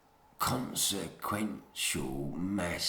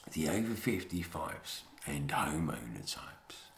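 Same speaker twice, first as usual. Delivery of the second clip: whisper; 7 semitones lower, 8 dB quieter than usual. Real, not acted. Drops to a whisper.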